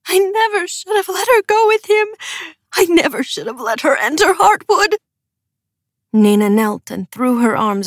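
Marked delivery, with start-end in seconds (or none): 0.1-5.0 much higher, grieving mother's lament; Ylva's broken lament; broken moan. A narrator's reading. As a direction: moan it through her grief. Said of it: "Ylva let loose a broken moan".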